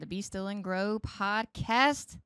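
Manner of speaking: slow, loud sing-song welcome; drawn-out greeting to listeners; slow showy hello; host welcome. Acted. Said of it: "host's sing-song welcome".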